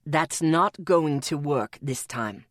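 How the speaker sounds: deep, slow man's warning; low warning voice. A narrator's reading. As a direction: warn her low and slow. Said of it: "Atticus warned".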